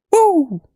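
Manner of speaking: a sudden high excited whoop. Real, not acted. Cheering for the stream with a high excited whoop.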